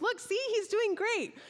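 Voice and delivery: high-pitched, eager proof of good news; upbeat reassurance. Retold or imitated. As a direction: show a worried mum all is well. Acting out reassuring the anxious mother.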